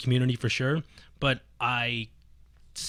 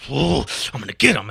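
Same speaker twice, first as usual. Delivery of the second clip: loud mock-furious threat; retold pissed-off outburst; 2 semitones higher, 7 dB louder than usual. Player imitating pissed-off friend pacing the arcade, loud angry outburst. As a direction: burst out loudly as the angry friend. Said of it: "he was fucking pissed".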